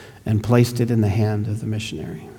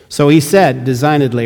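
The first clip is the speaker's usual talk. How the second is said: normal talking voice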